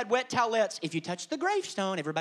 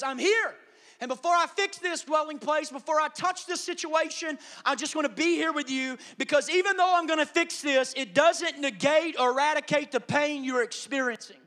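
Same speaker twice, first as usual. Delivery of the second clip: high, raised voice full of conviction; emphatic reassurance; 7 semitones higher, 5 dB louder than usual. Acted. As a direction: preach it as Jesus' insistent promise to stay. Acts Jesus answering the hurting.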